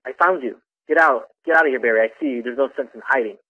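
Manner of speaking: ordinary delivery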